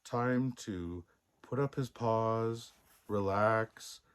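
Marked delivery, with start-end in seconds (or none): none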